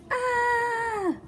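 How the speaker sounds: high scared shriek; roller coaster scream. Acted. Kids screaming on a roller coaster. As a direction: scream on the drop.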